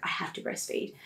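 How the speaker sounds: unvoiced, soft "I have to breastfeed"; inner-voice whisper. Dietitian telling herself she must breastfeed, whispered. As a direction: whisper it to yourself.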